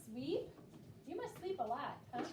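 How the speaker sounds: ordinary talk, nothing marked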